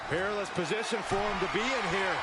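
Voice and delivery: live play-by-play, rising at big moves; wrestling commentator. Real, not acted. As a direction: call the match live. Play-by-play call of the match.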